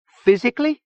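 high, incredulous snap; furious. A narrator's reading. Zona reacting to Gomi Boy's dismissal, furious. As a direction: throw the word back angrily. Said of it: "snapping back into a furious version".

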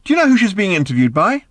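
high, loud question shouted across rooms; calling out. A narrator's reading. Arthur calls out to Ford in the next room, high and loud. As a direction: shout the question through the doorway.

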